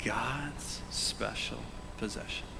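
slow, breathy whisper; quiet emphasis. Shown as a demonstration. Pastor saying the verse softly for emphasis. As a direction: hush it to make it land. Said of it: "I said that kinda quietly".

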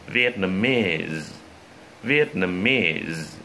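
Vietnamese very slowly, final "ee" drawn long; slow model pronunciation. Shown as a demonstration. Teacher gives students practice words to hear, slowly enunciated Vietnamese. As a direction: stretch out Vietnamese, syllable by syllable.

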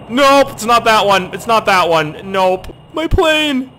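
high, loud cries of dismay; loud panic, climbing to a wail; panicked shouting. Real, not acted. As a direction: yell as the plane goes down. Wrong control pressed, plane crashing.

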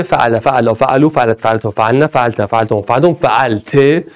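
student answering. Retold or imitated. Voicing a student reciting past forms, lightly. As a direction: recite it like a pupil under their breath. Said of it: "میگی".

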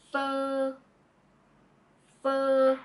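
pho held long, dipping down then rising; Vietnamese pho. Shown as a demonstration. Tutor models the whole word pho for learners. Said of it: "So it is".

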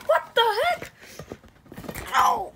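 high, loud play-acted protest; startled yelp. Toy knocked about in play, startled high yelp. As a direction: yelp as if suddenly hit.

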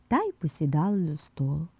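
ordinary delivery, nothing marked

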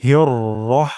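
হিয়র রহ with a short, heavy রহ; correct recitation. Shown as a demonstration. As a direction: clip রহ short, no long আ. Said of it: "রাহ কখনোই বলবো না". (সঠিক উচ্চারণ রহ)

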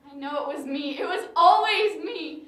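rising to a loud, pained cry of self-blame; anguished insistence swelling into a loud cry; anguished outcry. Acted. Grieving widow insists on blame.

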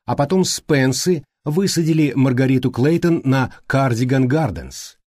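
usual speaking voice

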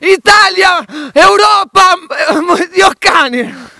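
rising crying shout. Real, not acted. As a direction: yell it out, voice breaking. Ranting shout, voice cracking.

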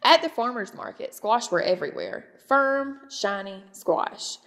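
ordinary delivery

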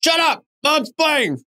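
high-pitched mock shout; mock yelling mom. Mom yelling at kids while playing soccer, mock yell. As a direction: imitate a mother yelling back at her kids. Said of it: "not that you yelling at your kids".

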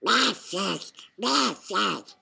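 loud, garbled duck quack-talk; Donald Duck shout. A narrator's reading. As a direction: squawk the words as a barely intelligible duck. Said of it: "shouted Donald".

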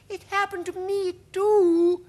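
very high, loud, slow ladybird; squeaky-high creature voice. A narrator's reading. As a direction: pipe up excitedly as a ladybird. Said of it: "said the ladybird".

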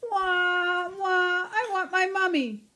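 put-on high, wailing baby mouse voice; crying Baby Mouse. A narrator's reading. Baby Mouse crying for his mummy, high wail. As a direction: wail for mummy like a tiny child. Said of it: "cries Baby Mouse".

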